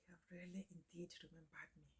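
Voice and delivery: sigh, then whisper it; reverent, very soft whisper after a sigh; hushed whisper. Real, not acted. Sighing, moved by the name's meaning.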